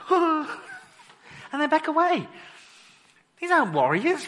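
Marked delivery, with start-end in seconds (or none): 0.1-2.3 laughing. Real, not acted.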